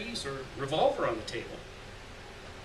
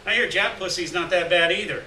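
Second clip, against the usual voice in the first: ordinary delivery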